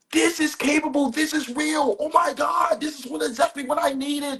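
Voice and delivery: high, thrilled exclamations; excited client. Retold or imitated. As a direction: gush like it's exactly what you needed. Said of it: "shift your paradigm of".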